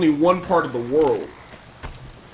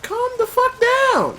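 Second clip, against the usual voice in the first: mock voice; slower than usual. Real, not acted. Enemy attacking.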